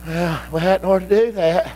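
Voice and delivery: low, resigned give-in; a hint of a weak-willed sigh; meek spiritual man. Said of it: "Spiritual man goes".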